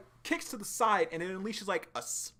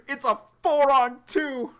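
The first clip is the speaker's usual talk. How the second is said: higher, slower, louder dramatic reading; hammed-up, slow dramatic take on it; dramatic character voice; 10 semitones higher, 11 dB louder than usual. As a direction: draw it out like a tense anime line. Dramatic second take of the line.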